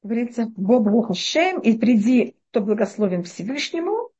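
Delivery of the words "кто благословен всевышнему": ordinary talk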